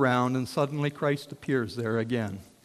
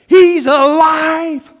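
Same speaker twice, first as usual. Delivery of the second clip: slow, much higher and louder triumphant cry; joyful cry; 13 semitones higher, 17 dB louder, much slower than usual. Acted. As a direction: proclaim it with all your joy. Disciples announce the resurrection.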